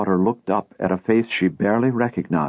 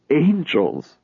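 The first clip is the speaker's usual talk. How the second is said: bitter scorn; 5 semitones higher than usual. A narrator's reading. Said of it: "Lot's wife laughed bitterly".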